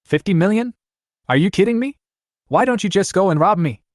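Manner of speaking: the figure hits him like a slap; shocked. A narrator's reading. The man reacts in shock to the price, high and incredulous. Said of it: "The man was shocked".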